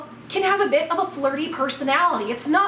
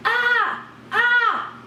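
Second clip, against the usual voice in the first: high, startled "ah"; two short yelps of mock alarm; freaked-out gasp; 6 semitones higher than usual. Acts out her freaked reaction to hand.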